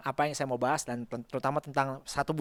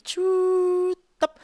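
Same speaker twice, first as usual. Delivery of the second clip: mock car door click. Imitating self-closing luxury car door.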